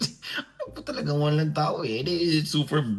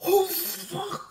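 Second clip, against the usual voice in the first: high, startled mock yelp of "Oh, fuck!"; American guy swearing. Caught in the airport toilet, high startled yelp. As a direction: squeak it out like a shocked foreigner. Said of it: "Sabi niya".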